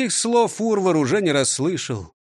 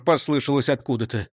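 ordinary delivery, nothing marked

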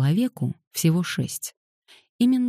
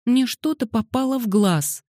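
put-on high child's whiny complaint; plaintive small-girl voice; whimpering little girl; 9 semitones higher than usual. A narrator's reading. Whimpering girl rubs her eyes.

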